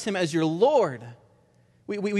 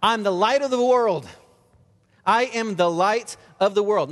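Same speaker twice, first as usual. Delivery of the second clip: "I'm the light of the world" called out higher and louder; yelling out; 3 semitones higher, 4 dB louder than usual. Retold or imitated. Jesus cries out before the crowd at the feast, loud and high. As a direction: proclaim it loud across the temple crowd. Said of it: "he yells out".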